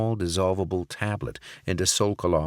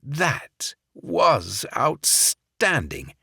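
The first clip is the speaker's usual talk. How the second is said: higher, very slow fake English accent; 8 semitones higher, much slower than usual. Barrett praising the wine in fake English accent. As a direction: drawl out the praise. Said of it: "in his fake English accent".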